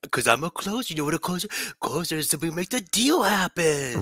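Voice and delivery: high imitation of talking to a toddler; playful squeaked-up mimicry; mock baby talk.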